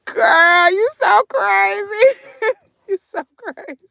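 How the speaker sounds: high, loud laughing squeal, fading into giggles; cracking up. Real, not acted. Telling a viewer she's crazy, cracking up. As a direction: laugh helplessly through the words, drawing them out.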